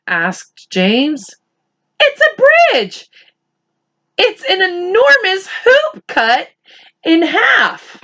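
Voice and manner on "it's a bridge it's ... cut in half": excited. Acted. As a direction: gasp it out in wonder. Excited character sees arc.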